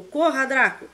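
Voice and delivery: high, loud urgent shout; shouted order. A narrator's reading. Snape shouts at Draco to run, loud urgent. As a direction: bark the order to flee. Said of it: "O professor gritou".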